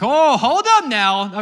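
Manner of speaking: loud, high, drawn-out objection; hammed-up shocked crowd reaction; taken-aback protest. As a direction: balk loudly, stretching it out. Crowd balking at shocking words.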